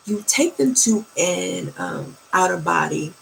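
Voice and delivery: ordinary talk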